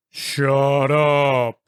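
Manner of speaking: a hint of a deep menacing voice, lightly done; mock low scary voice. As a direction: growl it like a faked monster voice, lightly. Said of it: "making my voice as low as possible".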